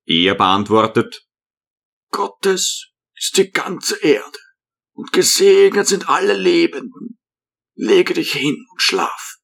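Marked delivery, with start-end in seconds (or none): 2.1-9.4 put-on old hermit. A narrator's reading. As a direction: speak like a gentle old monk.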